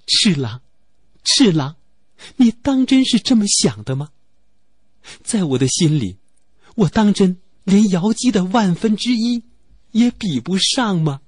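声音抬高，心碎地连声质问; 一声比一声高，痛心地追问负心人; anguished plea. Acted. Heartbroken girl confronts faithless lover. (对负心的赤狼心碎高声质问)

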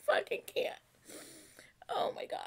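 high, breathy crying laugh; laugh-crying, words dissolving into tears; sobbing laugh. Real, not acted. Fan sobbing and laughing at the two singers.

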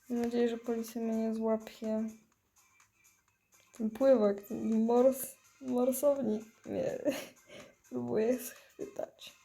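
ordinary talk, nothing marked

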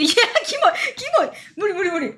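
real disgusted squeals; grossed-out squeal. Real, not acted. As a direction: shriek out how gross it is.

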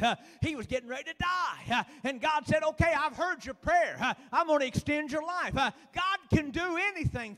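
loud, rhythmic preaching with "uh" beats; full-force preaching. Acted. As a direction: drive it home like a revival preacher.

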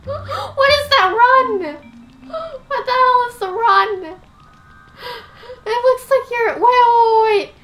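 high, loud, drawn-out disbelief; real squealing alarm, gasping out the words; horrified shock. Real, not acted. Player reacts to the professor's run, high horrified outburst.

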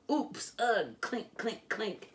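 high dismayed oops, then light clinks; oops and clink sounds. A narrator's reading. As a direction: play the tooth dropping down the drain. Tooth falls down drain.

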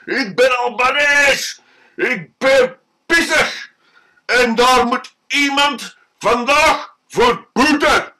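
put-on angry drunk yelling in the shop; drunk man shouting. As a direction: bellow it like a furious drunk. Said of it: "staat te schreeuwen".

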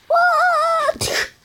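very high startled what; startled squeak. Acted. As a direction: squeak it out, shocked.

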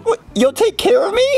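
hopeful surprise. Acted. Kid hopeful.

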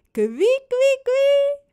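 high, loud tickling noise climbing upward; playful tickle squeal. Acted. Robin wiggles his fingers at baby Suze, playful loud squeal.

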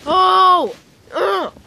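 loud high cries at the cold; gasping from cold. Real, not acted. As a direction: shout through the freezing shock. Reacting to snow bite.

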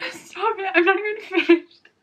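high, tear-choked protest; pleading through real tears; still crying. Real, not acted. Crying while reading a book.

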